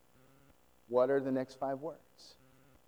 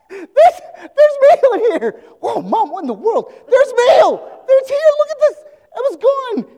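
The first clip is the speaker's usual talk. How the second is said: much higher, much louder gasping laughing shouts; thrilled shout; 18 dB louder than usual. Retold or imitated. Discovering the barrel refilled, ecstatic laughing shouts. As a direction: explode with disbelieving joy. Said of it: "she probably pours it out and be like".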